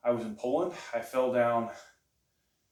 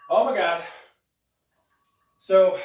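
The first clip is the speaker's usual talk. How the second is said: higher, louder, amazed exclamation; excited; 9 semitones higher, 13 dB louder than usual. Real, not acted. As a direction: burst out amazed after the lift. Reacting right after the lift.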